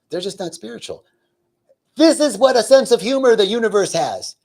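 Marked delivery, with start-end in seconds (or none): 0.1-1.0 softer, higher, understated judgment; private thought. Retold or imitated. Meditating man silently judging his neighbors, hushed. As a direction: think it quietly to yourself. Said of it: "I'm thinking to myself".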